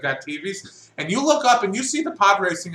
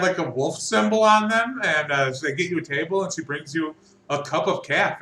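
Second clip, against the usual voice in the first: normal talking voice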